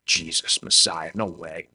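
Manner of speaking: low, soft scoffing to himself; inner battle. Acted. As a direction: mutter it dismissively, in broken bits, with a huff. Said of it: "he's battling back and forth".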